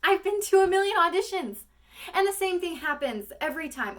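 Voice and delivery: high, loud, strained audition speech; monologue, first take. Acted. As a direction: blurt it out as a frustrated actress, voice pushed high. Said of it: "that's when the monologue starts".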